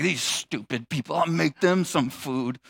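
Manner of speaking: low, hushed grumble under the breath; angry muttering. Acted. Cook grumbling alone in the kitchen, angry muttering. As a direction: mutter crossly to yourself, keeping it down. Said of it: "Have you ever cooked angry?".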